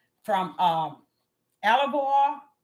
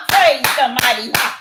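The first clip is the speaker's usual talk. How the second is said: loud praise; 8 dB louder than usual. Real, not acted. Preacher exclaims praise.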